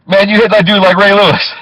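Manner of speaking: high, loud hyped-up praise; impressed shout. Retold or imitated. Thug driver hyping up the cab driver, loud and high. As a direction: shout it like you just saw a huge hit.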